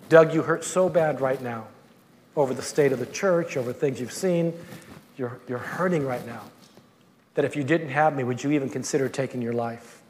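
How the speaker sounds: soft, low, gentle questioning; tender voice of the Lord. Retold or imitated. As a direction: speak it softly, like a caring father. The Lord speaking gently to him.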